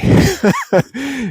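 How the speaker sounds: short, high chuckle; a brief real laugh at the setback. Real, not acted. Laughs about deep snow delay.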